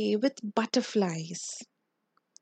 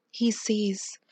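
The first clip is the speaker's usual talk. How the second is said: ordinary delivery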